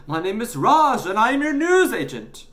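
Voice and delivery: high, loud self-introduction; indignant. Acted. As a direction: insist on who you are, loudly. Newsagent indignantly corrects the boy.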